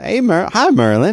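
bright high cheery hello; mock show greeting. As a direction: greet like a host. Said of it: "And you'll say".

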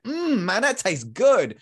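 high, loud, savouring "mm"; delighted taster. Acted. Shopper just given a free cookie sample, acted delight. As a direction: act out relishing a bite, pitched well up. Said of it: "And you're like".

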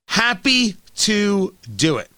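slow, savoring sarcasm; slow, drawn out. Shown as a demonstration. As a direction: drag it out smugly. Repeats phrase, second slowed.

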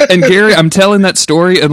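normal talking voice

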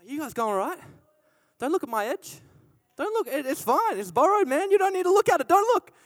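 high nervous denial; nervous cover-up. Pretend. Worker hiding lost axe head.

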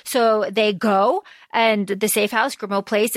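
ordinary talk, nothing marked

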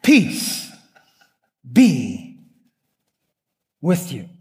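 a tender hushed blessing; gentle Jesus. Acted. Jesus greets those who betrayed him.

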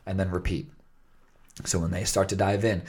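ordinary talk, nothing marked

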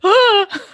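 sudden high gasping "Ah!"; a burst of shocked laughter; loud guffaw. Retold or imitated. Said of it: "I literally, like, guffawed".

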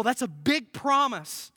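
ordinary talk, nothing marked

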